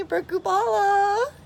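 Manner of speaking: ordinary talk, nothing marked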